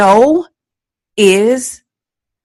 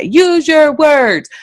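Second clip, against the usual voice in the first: higher, drawn-out stock parental phrase; imitating nagging parents; 7 semitones higher, slower than usual. Imitating people telling a crying child to talk, drawn-out. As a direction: stretch each word in a mock parental voice. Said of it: "like people say".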